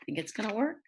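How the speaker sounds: plain reading voice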